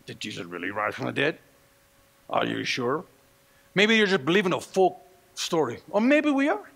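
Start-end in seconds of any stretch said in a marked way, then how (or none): 0.1-3.0 much lower and softer questioning; a hushed, skeptical voice in the pews; doubting whisper. Retold or imitated. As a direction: plant the doubt quietly.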